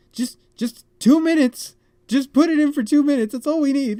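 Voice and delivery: high, loud, insistent instructions; urgent instruction. Retold or imitated. Stressed guest telling friend's brother how to heat water, urgent and loud. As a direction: raise the voice and push it up high, impatient.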